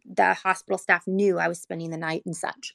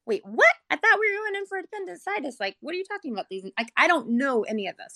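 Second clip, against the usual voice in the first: sharp, much higher retold "wait, what?"; mother hearing the surgeon's news, stunned and confused; shocked disbelief; 7 semitones higher than usual. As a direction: relive the jolt of bad news. Retelling shock at doctor's news.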